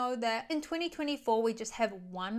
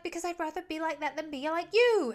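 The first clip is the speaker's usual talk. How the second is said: higher, louder comeback; mock retort; 6 semitones higher, 4 dB louder than usual. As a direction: fire the line back at him, higher. Gigi snapping back at Alex.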